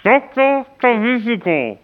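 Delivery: muffled, mumbling through dental packing; mouth full. Pretend. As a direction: garble the words round gauze. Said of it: "weil Sie eben die, irgendwas im Mund haben".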